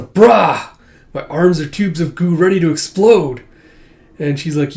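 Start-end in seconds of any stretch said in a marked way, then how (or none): 0.0-3.4 high, loud exclamation; loud outburst. Acted. Alma coming out from the bath, loud outburst.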